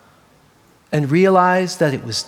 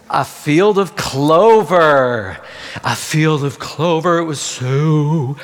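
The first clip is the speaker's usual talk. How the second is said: savoring; 4 semitones lower, much slower than usual. A narrator's reading. Relishing the lamb's favorite food.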